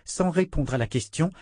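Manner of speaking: usual speaking voice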